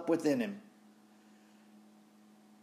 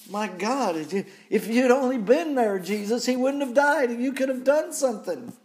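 higher, louder anguished complaint; distraught Martha; 9 semitones higher, 5 dB louder than usual. Acted. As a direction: plead in grief, voice pitched up.

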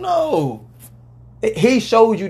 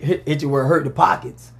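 ordinary delivery

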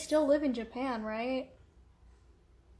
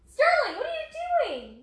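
high, loud startled yell; alarmed shout at dog; 6 dB louder than usual. Real, not acted. Owner yelling at her dog on the cat tower, high and alarmed.

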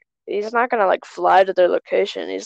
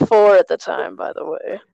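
ordinary talk

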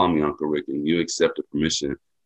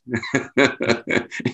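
a quick high chuckle at himself; light self-mocking laugh; 8 semitones higher than usual. Real, not acted. Joking about being thirty-two, a quick high chuckle. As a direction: laugh off the age joke. Laughing at his own age.

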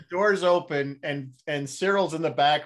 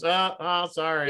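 imitated trick voicemail; 4 semitones higher, 5 dB louder, slower than usual. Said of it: "and then it goes".

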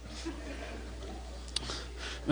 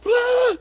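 very high "ah" squeal; mock panicked scream; 3 dB louder than usual. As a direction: squeal like worked-up kids. Imitates kids all worked up.

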